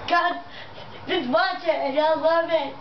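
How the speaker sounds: normal talking voice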